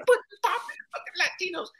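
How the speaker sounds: put-on high, scolding old man voice; lecturing Cosby impression. Comic as Cosby scolding him in front of cast, high lecture. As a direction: wag a finger in a squeaky lecture.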